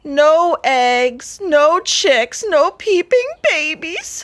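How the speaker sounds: loud, high wailing hen. A narrator's reading. Hen who lost her eggs wails loudly, heartbroken. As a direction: wail it out in despair. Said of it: "Henny wailed so loudly".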